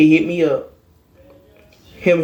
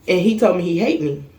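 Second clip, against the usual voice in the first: ordinary talk, nothing marked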